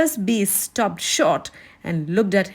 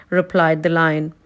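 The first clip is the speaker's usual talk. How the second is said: ordinary delivery, nothing marked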